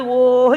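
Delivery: loud, drawn-out mock wail; storyteller's loud imitation of a howl; imitated crying. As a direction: mimic a child bawling on one held vowel. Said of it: "ఏడుస్తున్నాడు".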